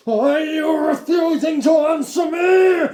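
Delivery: high, loud, slow roar; shouting. A narrator's reading. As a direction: demand it furiously. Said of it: "he shouted".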